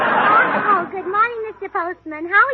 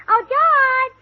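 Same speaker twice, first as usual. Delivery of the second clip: higher, louder call across the house; raised call to her husband; 7 semitones higher, 6 dB louder than usual. Acted. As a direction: call him from the next room. Calling husband from another room.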